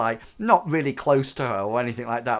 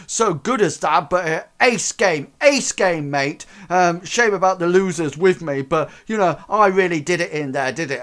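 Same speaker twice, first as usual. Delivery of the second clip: higher, louder cheerful boast; smug gamer; 6 semitones higher, 7 dB louder than usual. Acted. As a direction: brag while shaking hands. Crank thanks GM, smug.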